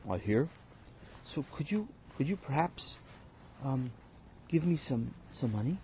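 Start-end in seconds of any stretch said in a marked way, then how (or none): 1.2-5.8 quiet, hesitant worker asking for his pay; softly pleading worker; timid hired hand. Acted. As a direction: ask shyly, barely daring. Worker timidly asks employer for wages.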